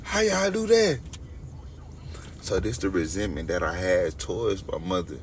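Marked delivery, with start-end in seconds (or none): 0.0-1.0 hurt, outraged yelling at friends who got rich; indignant shout. Retold or imitated. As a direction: shout it out, high and loud, feeling forgotten. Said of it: "I'm like".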